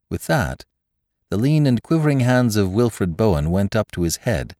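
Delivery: ordinary delivery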